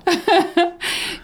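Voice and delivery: squealing laugh at the joke; high burst of laughter. Real, not acted. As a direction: crack up, high and loud. Friend's joke about drawing.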